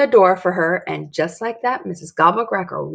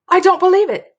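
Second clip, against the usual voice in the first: higher and louder astonished cry; disbelief; 11 semitones higher, 6 dB louder than usual. Acted. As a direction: gasp it out in disbelief. Dory amazed guest survives.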